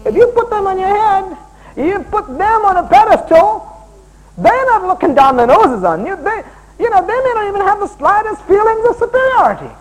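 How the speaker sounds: high, loud preacher's climax; preaching at full force. Acted. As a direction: drive the point home to the congregation.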